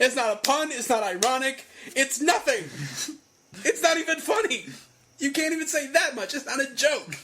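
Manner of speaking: high-pitched, mounting indignation; worked-up comic outrage; exasperated rant. Real, not acted. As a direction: protest each point in disbelief. Outraged rant about bad pun.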